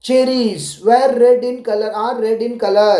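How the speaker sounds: slow, careful model answer; spelled-out teacher answer. Shown as a demonstration. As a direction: say it slowly so the child can copy. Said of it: "ऐसे आप बोलिए".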